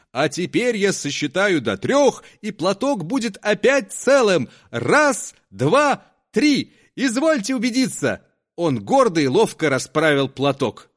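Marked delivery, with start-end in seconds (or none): 0.1-8.2 high, proud showman count; conjuror's triumphant count. Acted. Conjuror counting to three before the reveal, triumphant.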